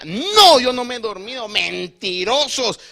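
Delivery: high, loud protest, slowing on "mentirosos"; hammed-up furious insistence; angry denial. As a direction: accuse them all. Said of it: "Dijo, se enojó".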